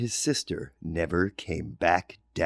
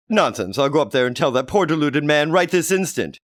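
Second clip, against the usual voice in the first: lightly voiced, louder and firm; brisk mother; 7 semitones higher, 5 dB louder than usual. Johnny's mother insists on going up, brisk and firm. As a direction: brush it off and march off.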